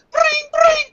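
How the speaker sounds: very high-pitched phone trill; imitated phone ring. Pretend call to Albert Del Rio, imitated ringing.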